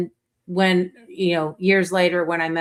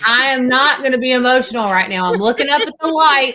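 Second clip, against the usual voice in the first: higher, louder, voice pushing through real tears; holding back tears; 5 semitones higher, 5 dB louder than usual. Real, not acted. Mother fighting tears while telling friends about her son's praise, loud and higher. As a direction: force it out over rising emotion, ending in a near shout. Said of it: "we're getting emotional".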